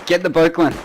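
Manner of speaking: high loud shout to a teammate. Real, not acted. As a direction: bark the order over the fight. Shouting to teammate under fire.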